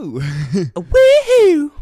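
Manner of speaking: high, very slow woohoo; mock woohoo. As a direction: stretch out a silly cheer. High drawn-out cheer.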